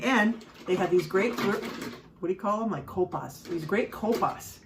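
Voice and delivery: normal talking voice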